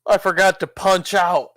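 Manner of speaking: high, loud imitation of a manic outburst; mock outburst. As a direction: blurt it out loud and high, playing the crazy Joker. Acting out Joker's outburst, film retelling.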